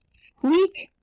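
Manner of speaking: high rising bed squeak. A narrator's reading. Narrator imitates the brand new bed, high rising squeak. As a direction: squeak like bedsprings, pitch sliding up. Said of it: "she heard something squeaky".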